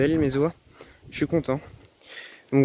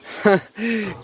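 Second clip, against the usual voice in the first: happy laugh; 5 semitones higher than usual. Real, not acted. Delighted about the chicks.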